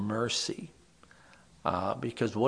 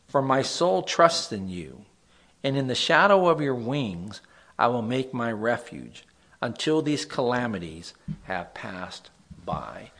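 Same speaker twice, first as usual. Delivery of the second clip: louder, measured reading of the psalm; scripture reading; 2 semitones higher, 4 dB louder than usual. Acted. Pastor reads Psalm fifty-seven to the group, solemn reading. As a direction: read the verse out like a prayer.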